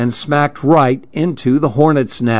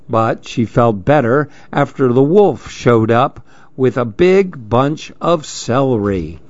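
plain reading voice

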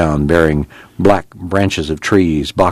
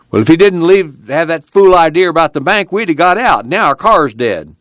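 high, loud complaint, slowing at the end; angry shouting; 11 semitones higher, 4 dB louder than usual. A narrator's reading. Neighbor blaming Pa over the dead car, loud and angry. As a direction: rail at them for their fool idea.

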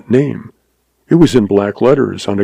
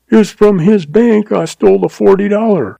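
frightened clerk; 10 semitones higher than usual. Acted. Frightened agent continues.

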